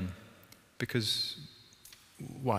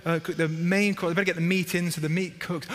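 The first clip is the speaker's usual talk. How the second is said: fretting Martha; 6 semitones higher than usual. Retold or imitated. Voicing Martha cooking.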